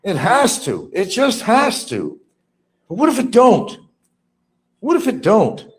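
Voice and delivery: high, insistent mock fretting; imitating Barry worrying. Voicing Barry fretting over the complaint, high mock worry. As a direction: fret in a high mimicking voice. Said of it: "He's saying".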